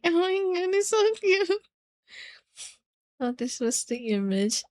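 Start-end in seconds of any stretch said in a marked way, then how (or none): 0.0-1.5 high squealy gush, retold; excited retold squeal; squealing fangirl. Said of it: "and I'm like".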